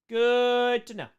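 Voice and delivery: high, loud, stretched-out first word; playfully stretched, high and loud; drawn-out singsong. Real, not acted. Drawn-out playful repeat of the phrase.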